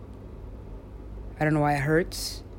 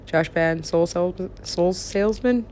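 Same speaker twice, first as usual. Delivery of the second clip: ordinary talk, nothing marked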